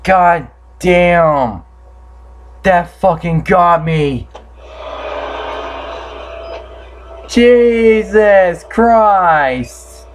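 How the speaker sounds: high, drawn-out cries of shock; shaken. Real, not acted. Gamer reeling from a scare that got them, shaken. Reacting after the jump scare.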